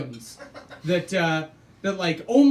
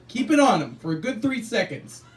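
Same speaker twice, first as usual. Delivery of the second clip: usual speaking voice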